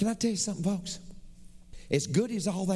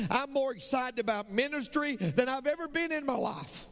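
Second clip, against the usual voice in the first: ordinary talk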